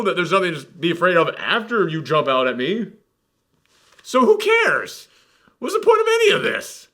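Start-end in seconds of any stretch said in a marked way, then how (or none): 4.0-5.1 high-pitched, incredulous outburst; exasperated. Real, not acted. Reviewer fed up with pointless jump scares, exasperated. 5.6-6.9 high-pitched, exasperated question; exasperated catchphrase. Real, not acted. Reviewer demanding the point of endless jump scares, exasperated. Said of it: "I've realized I say that a lot".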